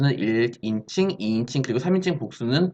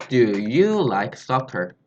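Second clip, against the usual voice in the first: slow model question; 3 semitones lower, much slower than usual. Shown as a demonstration. Teacher modelling a question for students, very slow. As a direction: Do you like soccer read very slowly. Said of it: "이렇게 물어본다는 거예요".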